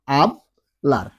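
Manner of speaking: আবলার drawn out slowly as ablar, h silent; slow demo pronunciation. Shown as a demonstration. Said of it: "Spanish ভাষায় এইচ এর কোনো উচ্চারণ হয় না".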